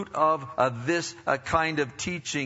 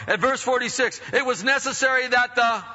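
high, loud verse cue; rousing preaching; 9 semitones higher, 5 dB louder than usual. Real, not acted. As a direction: read it out for the congregation to finish. Preacher cues congregation's response.

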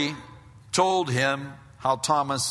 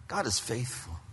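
ordinary talk

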